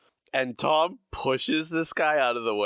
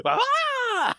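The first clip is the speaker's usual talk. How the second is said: very high, louder, hammed-up yelp; silent-film scream; 3 dB louder than usual. [name] cowering from the tiger, hammed-up scream. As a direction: shriek like a melodrama victim. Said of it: "something out of a silent film".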